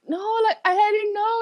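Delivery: high, apologetic pleading voice. Retold or imitated. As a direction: plead your innocence, embarrassed. Her younger self pleading.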